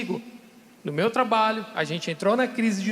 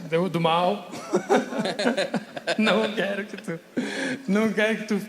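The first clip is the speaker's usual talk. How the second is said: words breaking into real laughter; laughing. Real, not acted. Telling his audience about his wife's advice, laughing. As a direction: chuckle through the quote.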